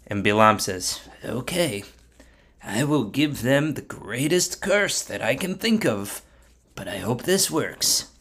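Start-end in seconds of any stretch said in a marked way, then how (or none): 1.2-8.1 softly spoken, unsure promise; subdued, hesitant; doubtful agreement. Acted. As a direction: agree quietly, hoping it works. Bilam doubtfully agrees to curse.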